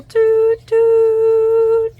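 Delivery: high, loud whistle-like toot; train whistle. Acted.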